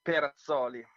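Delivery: surname said the Italian way, Perazzoli with rolled Italian vowels; Italian accent. Shown as a demonstration. Guest pronouncing his surname for the host, Italian accent. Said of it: "I'm gonna go very Italian now".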